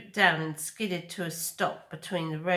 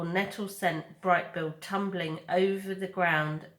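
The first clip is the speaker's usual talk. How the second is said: ordinary delivery, nothing marked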